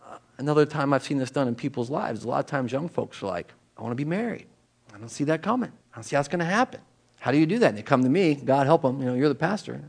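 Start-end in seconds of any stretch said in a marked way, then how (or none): 3.8-6.8 soft quote. Retold or imitated. Voicing worried young people.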